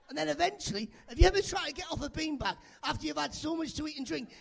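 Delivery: normal talking voice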